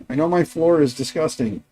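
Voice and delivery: ordinary delivery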